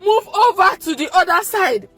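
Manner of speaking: high, loud, tearful command; crying prayer. Real, not acted. Mother praying over her baby's heart, crying. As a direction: plead through tears.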